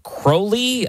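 Crowley as KROH-lee, high and questioning; alternative pronunciation. Shown as a demonstration. Host checking with guest, Crowley said KROH-lee. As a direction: say Crowley with a long "oh". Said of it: "how's the correct way to pronounce".

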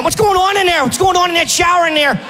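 put-on high shouted Pittsburgh accent. Comic mocking a snitching coach, shouted Pittsburgh accent. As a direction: yell it in a thick Pittsburgh accent. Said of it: "That horrible Pittsburgh accent".

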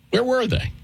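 where were they, pitched high in mock disbelief. Host challenging critics on air, incredulous and high.

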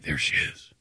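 hushed, low near-whisper; quiet voice. Retold or imitated. Afraid to approach his crush, in a quiet voice. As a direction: murmur it softly, barely voiced. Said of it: "my quiet voice there".

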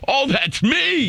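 high, slow mock realization; mock surprise. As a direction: squeak it out in fake surprise. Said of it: "And I went".

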